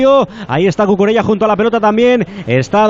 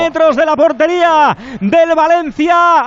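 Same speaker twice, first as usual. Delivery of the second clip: higher, slow, excited goal recap; excited commentary; 6 semitones higher, slower than usual. Real, not acted. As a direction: relive the goal, still buzzing. Goal replay description.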